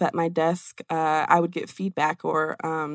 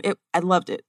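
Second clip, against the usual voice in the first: ordinary delivery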